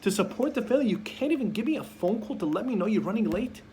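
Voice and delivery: a grown son protesting his parent's anger; mock-wounded young man's outburst; indignant son. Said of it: "He's like".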